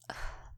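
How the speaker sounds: short, very soft sigh; small sigh. Acted. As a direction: breathe out a quiet sigh. Reacts to kid's thanks.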